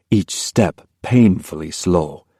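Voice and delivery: ordinary talk, nothing marked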